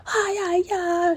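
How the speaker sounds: high-pitched squealed fangirl yell, held back in volume; stifled high screech of a starstruck fan; mock fan yelling. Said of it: "and she's yelling".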